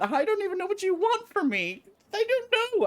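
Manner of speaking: put-on high, whiny crying boy voice; a boy-king blubbering at his captors; sobbing boy leader. GM voicing a sobbing boy leader, high and whiny.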